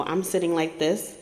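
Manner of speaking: normal talking voice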